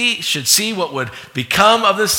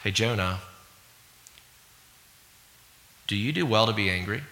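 gentle Lord; 6 semitones lower, 11 dB quieter, faster than usual. Acted. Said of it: "And the Lord said".

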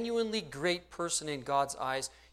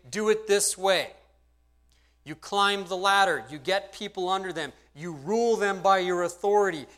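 higher and louder, a bossy worldly teacher; mock worldly coach barking orders; worldly instructor; 4 semitones higher, 5 dB louder than usual. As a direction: lay down the rules like a proud instructor. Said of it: "they're going to say".

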